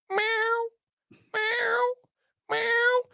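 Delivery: very high, thin imitated meow; cat meow. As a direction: mew like a poor cat. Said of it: "this poor cat".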